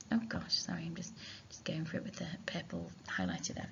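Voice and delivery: whispered apology under the breath; whispered aside. Real, not acted. Teacher whispering to herself over her highlighter slip. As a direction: drop to a whisper, talking to herself. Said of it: "aside while highlighting".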